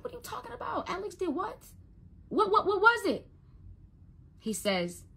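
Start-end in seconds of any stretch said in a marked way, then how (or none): 0.0-3.3 high-pitched stunned questioning; rising disbelief, high and urgent; retold alarm. Retelling her alarmed questioning of son.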